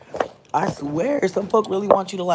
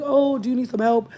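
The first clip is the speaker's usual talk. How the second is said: high, loud eager offer; mock cheerful neighbour offering help; eager helper; 10 semitones higher, 8 dB louder than usual. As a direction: imitate the lady springing to help. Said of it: "she was like".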